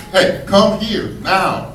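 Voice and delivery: barking a slow command at the dog; firm command. Retold or imitated. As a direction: call the dog sternly. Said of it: "I said, I whistled".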